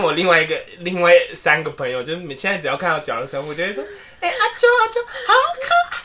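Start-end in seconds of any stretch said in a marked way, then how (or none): 4.2-6.0 much higher squeal of delight; squealing cute. Retold or imitated. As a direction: squeal like a fan of cute toys. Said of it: "学他欸".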